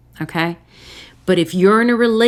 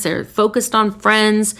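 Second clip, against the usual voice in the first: ordinary talk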